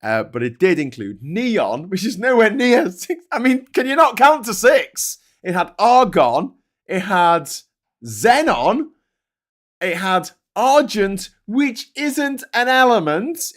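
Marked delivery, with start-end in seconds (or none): 1.9-13.5 much higher and louder, outraged disbelief; incredulous rant. Real, not acted. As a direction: rant like you can't believe it. Ranting about chatbot's wrong list.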